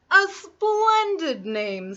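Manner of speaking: high, very slow, drawn-out praise; flattering wolf. Acted. Wolf flattering Little Red, slow oily charm. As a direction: lay on the charm, stretching each word.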